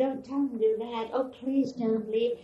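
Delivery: a soft, slow plea recalled from the dream; tearful plea. Retold or imitated. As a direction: beg gently through remembered tears. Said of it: "And I was crying".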